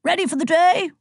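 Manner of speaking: high, hearty booming question; rally a young friend with gusto; jovial old-gent enthusiasm; happy roar. A narrator's reading. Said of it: "he roared happily".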